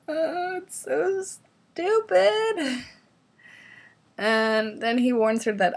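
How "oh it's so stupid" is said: much higher, slow disbelieving whine; high, drawn-out exasperation; incredulous. Real, not acted. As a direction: stretch it out in mock despair. Laughing disbelief at a quote.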